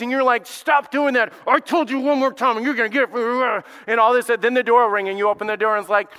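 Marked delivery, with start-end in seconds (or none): 0.4-3.6 louder, higher mock yell at the kids; hammed-up angry parent threat. Preacher acting a parent yelling at the kids, mock anger. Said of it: "you're yelling at your kids".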